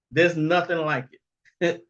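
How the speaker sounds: normal talking voice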